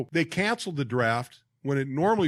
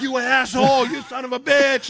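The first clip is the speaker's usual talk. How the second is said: high, loud mock outrage; retold angry shout; 8 semitones higher, 5 dB louder than usual. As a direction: curse out your buddy for pranking you. Acted cursing of prankster buddy.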